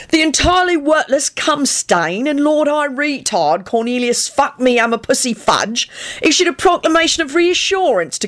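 high, mocking newsreader delivery; mock proclamation. A narrator's reading. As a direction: read it out as a pompous public proclamation. Sirius reads paper aloud, embellished.

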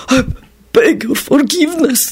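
high, despondent goblin plea. A narrator's reading. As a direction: beg dejectedly as a goblin. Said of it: "the goblin said despondently".

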